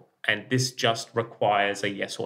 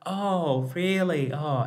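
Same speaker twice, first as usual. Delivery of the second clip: mock surprise; 6 semitones higher, much slower than usual. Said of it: "I was like".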